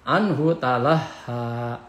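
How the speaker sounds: slow chant with a long final vowel; melodic, drawn-out verse ending; Quran recitation. Shown as a demonstration. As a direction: recite in measured tartil.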